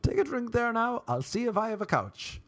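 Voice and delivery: put-on high-pitched parody of his drunk self; hammed-up tipsy host; drunken self. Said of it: "I think I was saying".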